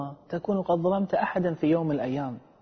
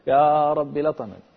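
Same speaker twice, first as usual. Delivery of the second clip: slow, louder plaintive cry to God; complaining prayer; 6 dB louder than usual. Retold or imitated. A wronged man crying out to God at night, slow plaintive plea. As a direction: draw out the complaint to the Lord. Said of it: "يشتكي يقول".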